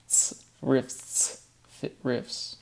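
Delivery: rifts breathed out with a clear T S cluster; whispered TS ending. Shown as a demonstration. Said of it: "the T S in there".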